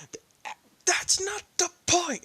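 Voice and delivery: high, breathless flustered denial; flustered protest. Acted. Izuku protesting to Momo, high and flustered. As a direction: sputter it out defensively.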